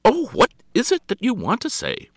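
high, loud impatient interruption; exasperated demand. A narrator's reading. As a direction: break in sharply, pitched right up. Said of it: "she broke in impatiently".

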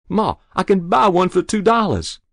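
put-on high, loud boyish pleading. A narrator's reading. Boy bargaining with his mother, pleading. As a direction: press her eagerly.

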